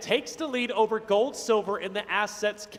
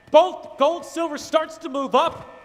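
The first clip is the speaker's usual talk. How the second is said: high, loud hype call; esports caster; 5 semitones higher, 4 dB louder than usual. Real, not acted. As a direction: cast it like the game hangs on it. Caster hyping the clutch moment.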